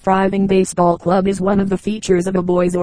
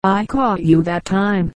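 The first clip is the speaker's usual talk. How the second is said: ordinary delivery, nothing marked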